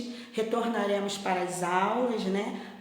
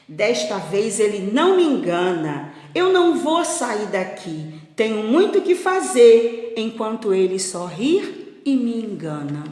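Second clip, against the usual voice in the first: loud, emphatic, mildly indignant; firm refusal; 7 dB louder than usual. Acted. Farmer refusing to be fooled by the boy's cries, firm indignation. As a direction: dig your heels in.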